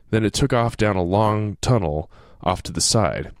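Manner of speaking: ordinary talk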